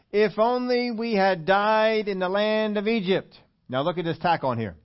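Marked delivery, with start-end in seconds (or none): 0.1-3.4 high, drawn-out hammed-up lament; theatrical self-pitying grievance; mock complaining. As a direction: play the congregation's complaint, slow and raised. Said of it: "complained against Moses and Aaron".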